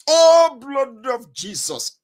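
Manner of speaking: loud, high prayer cry, then drawn out; fervent cry. Retold or imitated. Mother praying before travel, loud fervent cry. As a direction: burst out high and loud, imploring.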